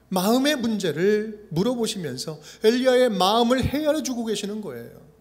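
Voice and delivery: normal talking voice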